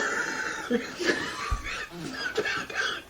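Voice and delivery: high, breathless wheezing cackle; wheezy cackle. Acted. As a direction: cackle at your own joke without voice. Laughing at own joke.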